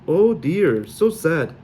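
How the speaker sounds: hammed-up Sully pity; exaggerated Sully-accented dismay; mock sorrow. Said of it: "My accent was even worse than Mal's".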